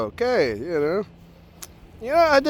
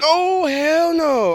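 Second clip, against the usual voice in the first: high, slow, drawn-out "oh hell no"; horrified refusal; 10 semitones higher, much slower than usual. Retold or imitated. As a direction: stretch it out in disbelief. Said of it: "I'm like".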